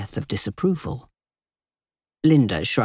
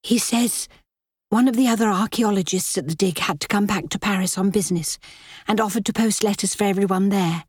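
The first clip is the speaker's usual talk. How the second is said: put-on lightly raised, subdued young woman; 6 semitones higher than usual. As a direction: answer flatly, holding back hurt. Kitty answers reluctantly.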